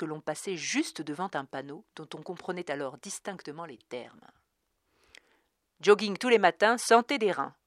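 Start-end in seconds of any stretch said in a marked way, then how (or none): 5.8-7.5 loud, high upbeat ad voice; bright slogan shouted like an ad; young man's voice. A narrator's reading. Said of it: "par une voix de jeune homme".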